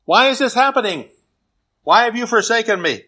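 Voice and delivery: high-pitched, anguished questioning; preacher's dramatized plea of the forsaken; crying out. Acted. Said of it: "Even Jesus cries out".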